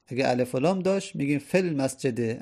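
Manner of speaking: ordinary talk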